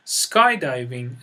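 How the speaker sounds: Skydiving slow, sky stressed. Shown as a demonstration.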